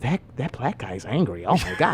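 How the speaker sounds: low, hushed imitation of gossiping passengers; mock whisper. Passengers gossiping about him, mock whisper. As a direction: drop it low and soft, mimicking onlookers. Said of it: "I heard every whisper".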